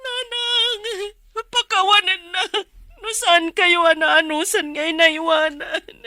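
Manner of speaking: high, tearful pleading to the dead; weeping plea. Acted. Child begging dead mother for forgiveness, weeping plea.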